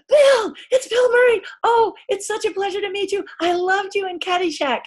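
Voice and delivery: high, loud, breathless fan gush; starstruck gushing. Retold or imitated. Young extra gushing at Bill Murray, high excited squeal.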